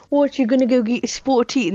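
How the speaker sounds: hammed-up British impression, loud and high; horrible British accent. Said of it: "that fucking horrible British accent".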